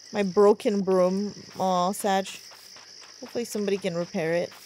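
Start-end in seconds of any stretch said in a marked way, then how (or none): none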